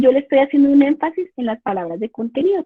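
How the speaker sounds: ordinary talk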